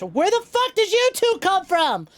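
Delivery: high, loud startled shout; shrieking in mock fright at two sudden arrivals; startled yell. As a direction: jump out of your skin and yell it high. Pal startled, yells at them.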